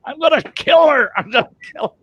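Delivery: high-pitched frantic threat, loud then dropping; enraged outburst. Retold or imitated. Patient trying to get out of that bed, enraged outburst. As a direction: burst out as if being held back.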